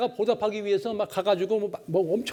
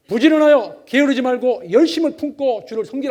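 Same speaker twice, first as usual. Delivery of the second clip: loud, high preaching of scripture; 3 semitones higher, 11 dB louder than usual. Real, not acted. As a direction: proclaim the verse to the congregation. Scripture quoted at full force.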